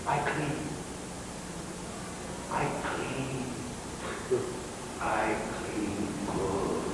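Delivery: very soft, halting submission; meek immigrant reply. Acted. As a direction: answer quietly and humbly, with long pauses. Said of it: "every ethnic group goes".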